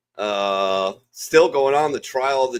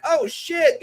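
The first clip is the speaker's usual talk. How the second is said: high surprised squeak; high-pitched startled oh shit; 3 dB louder than usual. Retold or imitated. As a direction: jump in shock. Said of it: "I'm like".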